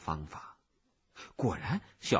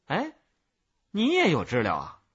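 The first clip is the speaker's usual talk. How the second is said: lively, louder curious query; curious question; 6 semitones higher, 9 dB louder than usual. A narrator's reading. Peddler asks the child about a cicada, curious. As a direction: ask with perky interest. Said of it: "好奇地问我".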